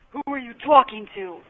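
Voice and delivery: lightly imitated East Coast mom; East Coast accent. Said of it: "she's got this East Coast accent and this real deep voice".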